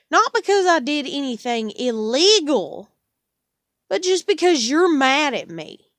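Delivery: real outrage, loud and high; angry. Real, not acted. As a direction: let the anger burst out. Angry rant at landlord.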